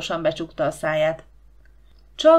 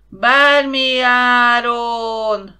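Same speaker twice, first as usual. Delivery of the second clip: slow, drawn-out crowd chant, higher; crowd agreeing loudly; 4 semitones higher, 9 dB louder, much slower than usual. A narrator's reading. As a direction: echo it like a square full of people. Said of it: "hangzott az egyetértés a téren".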